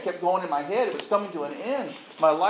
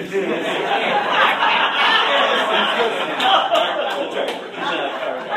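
big loud burst of real laughter; 6 semitones higher, 7 dB louder than usual. Real, not acted. Speaker to audience, laughing loudly at the liver count. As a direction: laugh out loud, long and high.

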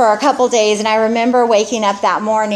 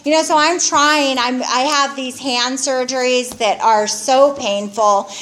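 ordinary talk, nothing marked

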